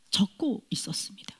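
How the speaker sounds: whispered, nearly without voice; hushed whisper. Real, not acted. Preacher telling the congregation of being moved, whispered in awe. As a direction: breathe the words out in awe.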